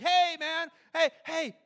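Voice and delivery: high, loud interjection; calling out. Retold or imitated. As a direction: cut in loudly to stop the bad news. Jesus cuts in on bad news.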